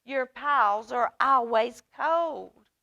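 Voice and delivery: a hint of a cranky big mouse; grumpy Big Mouse. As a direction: grumble it like a sleepy old mouse. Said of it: "said Big Mouse".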